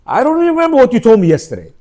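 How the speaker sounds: high, fast brush-off of her hurt; dismissive husband. Retold or imitated. Men shrugging off a wife's hurt, mock dismissive. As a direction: toss it away like it's nothing, quick and airy. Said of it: "We think".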